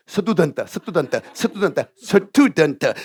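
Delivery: "스튜던트" with Korean vowels after s and t; Korean-accented "스튜던트", every consonant given a vowel; failed attempts. Shown as a demonstration. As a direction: practise "스튜던트" as a struggling Korean learner. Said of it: "이게 안 돼갖고요".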